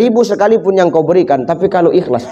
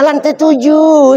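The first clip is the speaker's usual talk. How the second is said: mock prim wife; 9 semitones higher, 3 dB louder, slower than usual. Mock wife answers slowly.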